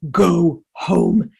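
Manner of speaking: loud mother bear shout, drawn out; furious protective roar. Acted. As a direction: roar them away from the cave. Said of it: "she roared at them".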